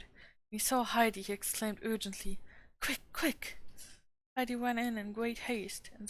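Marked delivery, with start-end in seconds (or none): 2.8-3.6 urgent whispered hiss, pitched up. A narrator's reading. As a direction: hiss it out in a hurry, no voice. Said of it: "hissed Sebastian again".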